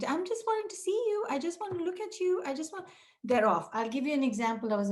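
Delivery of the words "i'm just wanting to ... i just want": put-on high, coaxing plea to a fleeing child. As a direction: sweetly coax a child who runs off.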